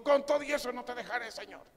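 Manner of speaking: high, loud impulsive disciple; Peter blurting out. Acted. Disciple swears loyalty to the Lord, impulsive loud outburst. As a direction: blurt out a vow as a rash follower. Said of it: "soltaba la lengua de volada".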